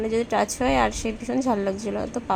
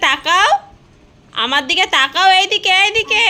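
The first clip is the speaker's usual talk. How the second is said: calling out; 8 semitones higher, 10 dB louder than usual. Real, not acted. Calling baby to look at camera.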